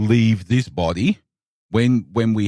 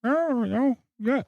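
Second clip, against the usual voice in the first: light mimic of a bored Little Britain character; a hint of a flat, knowing sitcom catchphrase; Little Britain wheelchair guy; 13 semitones higher than usual. Host imitating the wheelchair guy from Little Britain, lightly. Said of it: "the guy in the wheelchair going".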